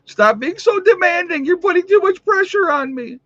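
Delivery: very high, loud silly voice; squeaky mock complaint. Host playfully protesting demands put on him, squeaky mock complaint.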